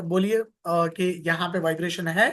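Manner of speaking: ordinary delivery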